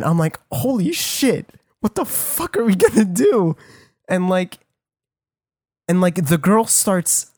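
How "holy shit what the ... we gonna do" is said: mild retold freak-out. Storyteller reliving finding a body, mildly freaking out. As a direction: replay a mild panic in a raised pitch. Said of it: "I start freaking out a bit".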